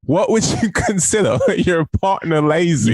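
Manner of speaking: high, slow, hammed-up cowboy-like accent; mock accent. Teasing the co-host with the chat question, exaggerated mock accent. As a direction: overdo a bad imitation accent, stretching the words. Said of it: "Why do you sound like a fucking cowboy".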